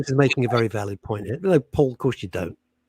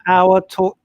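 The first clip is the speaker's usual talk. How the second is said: ordinary delivery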